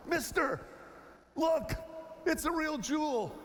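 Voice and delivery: mock kid pleading with a tourist, high; excited street kid.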